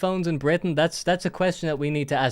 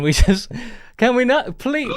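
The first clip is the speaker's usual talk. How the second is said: pleading; 9 semitones higher, 4 dB louder than usual. Retold or imitated. Group begging him to stop.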